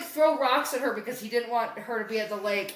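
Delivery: ordinary delivery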